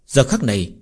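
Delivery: plain reading voice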